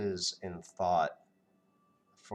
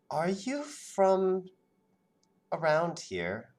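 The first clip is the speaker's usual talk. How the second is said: suspicious Natasha; 11 semitones higher, 3 dB louder than usual. Acted. GM voices Natasha, suspicious question.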